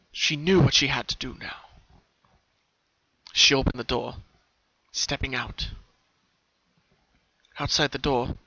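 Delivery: usual speaking voice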